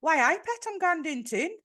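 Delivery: put-on light Geordie accent, Newcastle lilt; a quick Geordie impression. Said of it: "do your Geordie impression".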